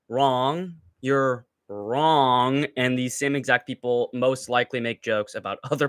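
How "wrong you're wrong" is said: ordinary talk, nothing marked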